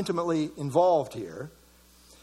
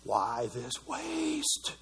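mock grumble; 9 semitones lower, 8 dB quieter, much slower than usual.

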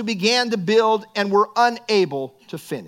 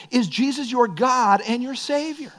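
ordinary talk, nothing marked

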